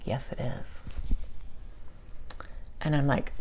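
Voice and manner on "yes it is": barely voiced, breathy soft answer; hushed reply. Gentle giant answering Shauna, barely voiced whisper. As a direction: let it out almost as a whisper.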